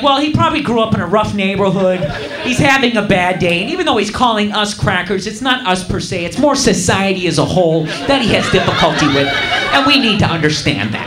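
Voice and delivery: low, measured voice of excuse-making; hushed-down, mock-sympathetic reasoning; mock understanding. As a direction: imitate an overly reasonable bystander, pitched well down. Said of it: "giving the other dude the benefit of the doubt".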